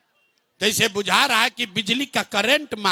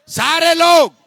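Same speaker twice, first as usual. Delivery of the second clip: calling out; 6 semitones higher, 6 dB louder than usual. Real, not acted. Calling out to the whole crowd.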